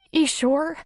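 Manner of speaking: high, rising cautious question. A narrator's reading. Woman offered a hanky, cautious. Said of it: "looked at it cautiously and said".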